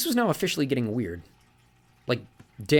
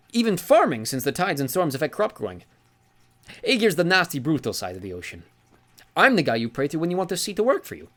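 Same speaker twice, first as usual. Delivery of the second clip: put-on genial old sea god; 8 semitones higher, 5 dB louder than usual. Sea god corrects Magnus, jovial and higher. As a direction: lean on Granddad with amused mock offence. Njord voiced in story.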